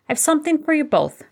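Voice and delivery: bright, airy offer with a high lift; breezy and easy, a small surprise; light tone. A narrator's reading. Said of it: "in a light tone".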